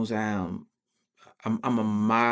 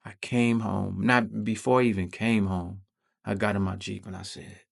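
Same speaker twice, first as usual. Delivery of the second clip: normal talking voice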